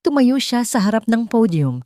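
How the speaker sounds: plain reading voice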